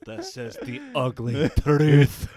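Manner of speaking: loud, slow put-on accent; Gerard Butler. Host quoting film title for laughs, mock actor accent. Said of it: "some more Gerard Butler movies".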